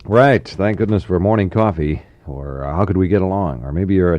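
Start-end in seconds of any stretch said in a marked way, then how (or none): none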